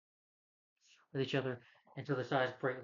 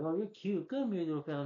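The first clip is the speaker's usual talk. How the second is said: put-on higher, sweet baby talk to a small creature; cooing at a cute critter; 5 semitones higher than usual. A turtle coos at the little Jersey Devil, higher baby talk. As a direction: coo at it like a puppy.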